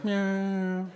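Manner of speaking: high mock cat meow. Neighbor meows at comedian.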